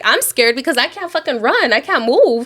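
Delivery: normal talking voice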